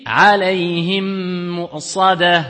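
slow chant, alayhim with the mim merged and nasal for two beats into mu'sadah; tajwid recitation. Shown as a demonstration. Teacher shows the merged mim, slow chanted recitation. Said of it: "on dit ici".

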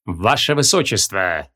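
slow, low and stately; regal king voice. A narrator's reading. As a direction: greet her like a grave monarch. King greets the princess.

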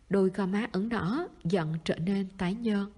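plain reading voice

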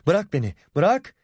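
heated protest, voice climbing; struggling to break free. A narrator's reading. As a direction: demand to be let go, rising in pitch. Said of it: "diye bir daha atıldı".

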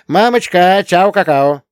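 put-on a girl calling goodbye to her mother; cheerful schoolgirl goodbye. A narrator's reading. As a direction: chirp it out while running off.

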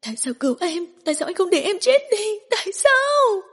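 crazed cry of why; frantic screaming. Acted. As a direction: build into a hysterical scream. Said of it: "phát điên thét lên".

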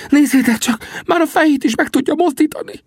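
very high, elated cry; high, loud rapturous character voice; near-ecstatic Sam. A narrator's reading. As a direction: marvel aloud, almost drunk with joy. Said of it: "Sam szinte mámoros hangját".

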